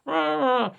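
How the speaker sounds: long high "aaah"; high-pitched held vowel; imitated "Ah!" noise. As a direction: mimic a film sound with a high, loud cry. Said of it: "ils ont fait".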